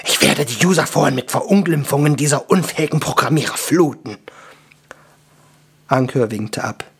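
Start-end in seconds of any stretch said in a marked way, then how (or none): 0.0-4.2 fast, gruff dwarf rant; gruff, irate dwarf grumbling. Acted. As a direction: rattle off the threat in a rough voice. Cursing dwarf threatens to complain.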